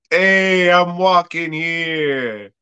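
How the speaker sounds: slow, high Brooklyn street shout; Brooklyn accent. Retold or imitated. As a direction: tough Brooklyn guy yelling at traffic. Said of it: "putting on his fucking Ninja Turtle Brooklyn accent".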